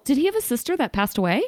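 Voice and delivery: normal talking voice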